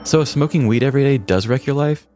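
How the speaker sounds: ordinary delivery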